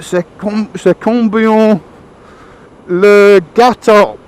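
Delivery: put-on high, slow English-accented French; halting schoolboy French. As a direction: belabour every word of bad French. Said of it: "trying to remember his French".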